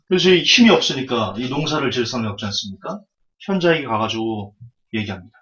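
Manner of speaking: usual speaking voice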